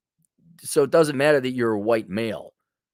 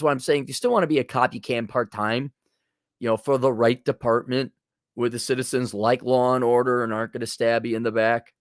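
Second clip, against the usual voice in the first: ordinary delivery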